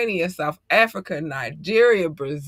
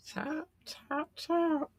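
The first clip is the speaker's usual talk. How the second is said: squeaky exclamation; 11 semitones higher, 11 dB quieter, much slower than usual. Real, not acted.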